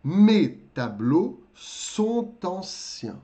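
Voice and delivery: Mes tableaux sont anciens, slowly; slow model sentence. Shown as a demonstration. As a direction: dictate it slowly to the class. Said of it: "Como é que eu vou traduzir".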